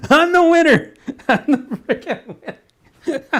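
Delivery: high, loud crowing through laughter; mock victory cry, cracking up; laughing gloat. Real, not acted. Host joking that he takes the prize himself, laughing gloat.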